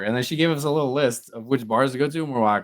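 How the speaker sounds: ordinary talk, nothing marked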